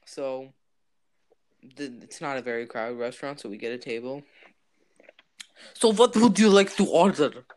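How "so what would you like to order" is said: put-on Russian waiter accent. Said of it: "not being racist with this accent".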